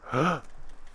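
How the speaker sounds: sudden shocked gasp; sharp gasp. Real, not acted. Falls to death in game.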